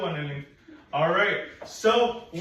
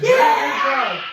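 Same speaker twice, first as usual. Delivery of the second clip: excited praise; 8 semitones higher, 11 dB louder than usual. Real, not acted. Teacher praising pupil loudly.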